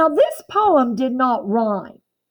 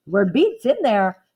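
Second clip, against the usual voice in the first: ordinary talk, nothing marked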